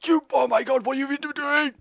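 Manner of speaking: very high squealed dismay; hammed-up alarm. Fighter under axe attack, hammed-up panicked squeal. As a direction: squeal in mock horror at your attacker. Acting being hit with axe.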